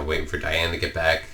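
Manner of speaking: ordinary talk, nothing marked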